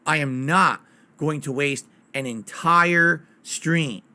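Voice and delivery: slow, separated words with weight on "not" and "entire"; emphatic insistence. Real, not acted. Streamer vowing to viewers after a botched launch, slow emphatic insistence. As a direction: spell it out slowly, landing hard on "entire stream". Firmly repeats his earlier vow.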